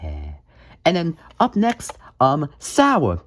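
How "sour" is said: ordinary talk, nothing marked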